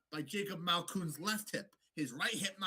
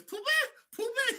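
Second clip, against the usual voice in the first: high, louder mock call of a nickname; high teasing Pooh Bear call; 5 dB louder than usual. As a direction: squeak it like a mocking coach. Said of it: "He goes".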